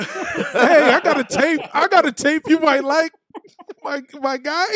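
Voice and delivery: high, loud hammed-up hustler pitch; mock tape hawker. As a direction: push the tape like an eager hawker.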